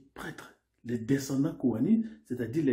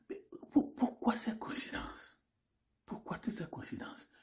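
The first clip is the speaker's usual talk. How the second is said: hushed, secretive whispered question; mysterious whisper; 8 semitones higher, 8 dB quieter than usual. Acted. Narrator whispering a mysterious question to the audience.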